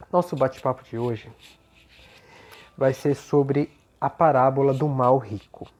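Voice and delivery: ordinary delivery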